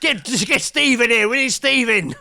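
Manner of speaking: office panic. Pretend. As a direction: shout for Steve in a mock panic.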